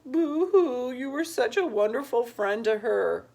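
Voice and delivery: high, loud mock weeping voice; mocking boo-hoo. Boy mocking Bill's mushy thanks, high loud fake sobbing. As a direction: ham up the sobbing to mock him. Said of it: "would laugh at and imitate later".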